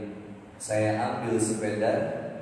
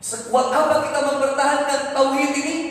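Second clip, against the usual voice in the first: raised, ringing sermon voice, higher and louder; preaching; 12 dB louder than usual. Acted. Preacher exhorting the congregation on lost property, full force. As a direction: preach it out to the crowd.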